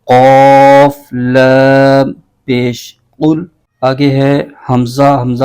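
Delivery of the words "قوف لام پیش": each letter name stretched very slowly; slow spelling drill. Shown as a demonstration. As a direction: spell out قوف لام پیش syllable by syllable, very slow. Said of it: "اس کو ملائیں گے". (قوف لام پیش کو بہت آہستہ ہجے کر کے)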